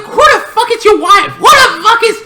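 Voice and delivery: very high laughing yell; laughing outburst. Retold or imitated. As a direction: shout it through laughter. Baffled character outburst.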